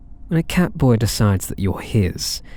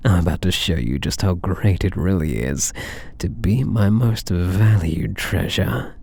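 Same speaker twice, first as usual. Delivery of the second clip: ordinary talk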